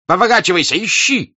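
furious high shout. Acted. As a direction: roar at the servant to search. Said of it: "вдруг закричал Денисов".

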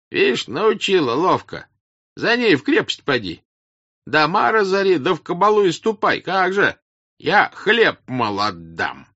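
very high, loud crowd voices; jeering peasants. Acted. Peasants mock the princess's offer, loud jeering. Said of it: "Слышались голоса в толпе".

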